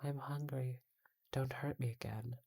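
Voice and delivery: put-on high, soft trembling child's whisper; a frightened little girl, whispering. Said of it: "a little girl saying with a trembling voice".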